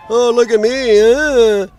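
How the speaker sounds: hammed-up mock tough girl; mocking a wannabe badass. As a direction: lampoon her showing off. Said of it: "trying to play badass".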